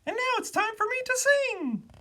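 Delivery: very high, loud little bird voice; high-pitched chirpy bird character. A narrator's reading. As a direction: squeak it out as a tiny bird. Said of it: "said the Bee".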